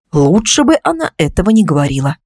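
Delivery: ordinary delivery, nothing marked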